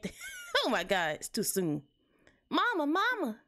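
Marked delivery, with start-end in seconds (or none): 2.5-3.4 put-on high-pitched child calling for mama; frightened bunny kid, pitched way up; little kid. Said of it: "That's the kid".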